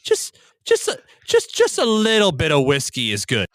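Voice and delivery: high, wavering grandma brushing it off; lightly voiced old grandmother, dismissive; grandmother's voice. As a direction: grandmother reassuring the mother. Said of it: "She's like".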